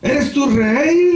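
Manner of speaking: high, drawn-out haughty question; imperious challenge. Acted. Pilate interrogates Jesus, high imperious challenge. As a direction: stretch it out as a lofty demand.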